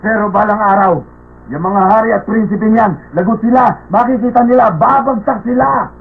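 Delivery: worked up and resentful, pitch riding high; angry rant. Acted. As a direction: rail against people you detest. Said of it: "ba't nagagalit kayo".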